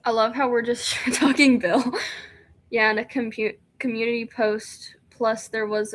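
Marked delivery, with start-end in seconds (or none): none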